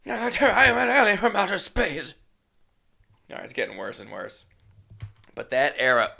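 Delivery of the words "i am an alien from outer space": higher, louder hammed-up alien voice; alien Lithgow. Lithgow's Third Rock alien introducing himself, hammed-up alien voice. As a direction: announce yourself as a goofy space alien. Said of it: "He was alien John Lithgow".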